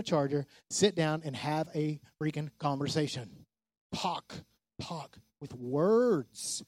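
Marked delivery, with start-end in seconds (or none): 3.9-6.6 slow, drawn-out talk with words; slow emphasis. Shown as a demonstration. Preacher to husbands and wives, very slow and deliberate. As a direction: stretch out each word, very slow. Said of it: "drawn out demonstration".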